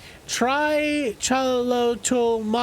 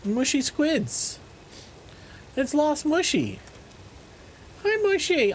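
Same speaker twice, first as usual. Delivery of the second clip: high, cooing pet voice; high-pitched talk to a stray pet; doggy talk; 7 semitones higher, 4 dB quieter than usual. Real, not acted. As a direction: coo up high to a lost little dog. Talking to a lost doggy.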